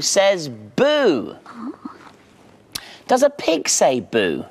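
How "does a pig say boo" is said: ordinary delivery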